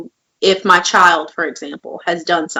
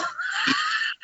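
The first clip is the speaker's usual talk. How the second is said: high squealing laugh at her own slip; cracking up after a slip of the tongue; burst of laughter; 12 dB quieter than usual. Real, not acted. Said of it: "Even playing field".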